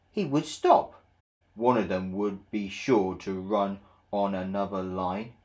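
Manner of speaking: usual speaking voice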